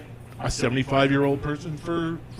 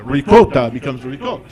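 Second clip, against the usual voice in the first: usual speaking voice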